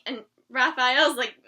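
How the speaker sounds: usual speaking voice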